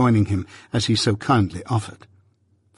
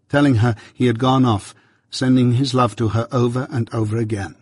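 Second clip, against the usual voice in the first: usual speaking voice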